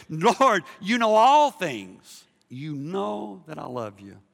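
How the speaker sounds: slow plea, loud then softening; drawn-out ALL, settling to a gentler close; shifted, earnest plea. Acted. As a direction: start strong and ease into sincerity. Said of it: "Now, now you shift".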